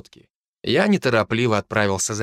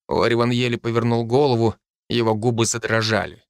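ordinary delivery, nothing marked

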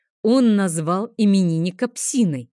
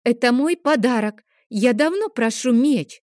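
put-on higher, louder pushy little boy; boy insisting the sword is his present, high and loud; child insisting; 6 semitones higher, 4 dB louder than usual. A narrator's reading. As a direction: stamp your foot over the gift. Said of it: "потребовал Лео".

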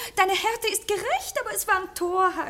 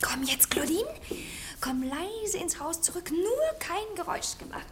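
softer, half-whispered urging; hushed urging to the maid; 3 semitones lower, 8 dB quieter than usual. Acted. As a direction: keep it down, they're sneaking home. Sneaking back into house.

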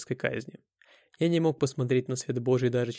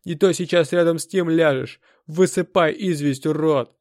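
very loud, raised bullying voice; angry shouted threat; 3 semitones higher, 8 dB louder than usual. A narrator's reading. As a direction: threaten the underling at full volume. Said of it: "крикнул бык". (злой громкий приказ с угрозой)

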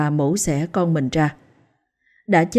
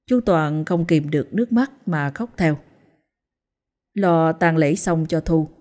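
ordinary delivery

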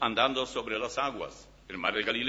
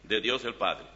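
scripture reading; 4 semitones higher, 5 dB louder than usual. Acted. Reads Philippians aloud from Bible.

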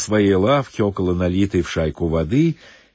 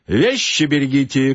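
bath attendant's shout; 6 semitones higher, 4 dB louder than usual. Acted. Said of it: "крикнул банщик".

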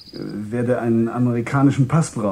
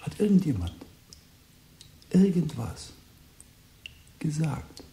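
higher, hushed, almost whispered; hushed question; 5 semitones higher, 6 dB quieter than usual. Acted. Tom quietly probing the frightened boy, hushed. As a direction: lean in and ask it under your breath.

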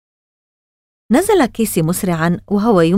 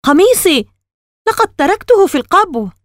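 high, louder cry of alarm; sudden exclamation; 10 semitones higher, 5 dB louder than usual. A narrator's reading. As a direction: burst out in sudden realisation. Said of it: "وفجأة هتف". (هتاف مفاجئ بصوت مرتفع)

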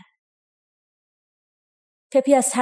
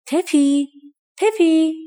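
calling out; 7 semitones higher, 6 dB louder, much slower than usual. A narrator's reading. Unseen voice calling Pepi.

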